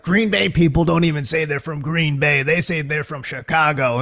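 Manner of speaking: just a hint of a grumbling fantasy analyst on a rant; Adam Rank impression. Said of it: "He's a pretty good Adam Rank".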